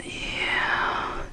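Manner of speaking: hushed whisper. Real, not acted. Showing viewers the sliced roast, whispering. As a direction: whisper it like a secret.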